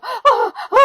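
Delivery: high mock sobbing cries; hysterical wail. Retelling crying in the car, high wailing cries. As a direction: wail like you're breaking down in the car. Con ese ataque de histeria llorando.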